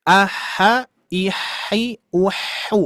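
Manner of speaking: aha ihi uhu with the h tinted by each vowel; match the h to the vowel; aha, ihi, uhu, the h following the vowel; vowel-coloured h. Shown as a demonstration. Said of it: "ketika mensukunkan ya".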